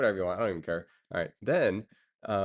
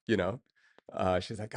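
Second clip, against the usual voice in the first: ordinary talk, nothing marked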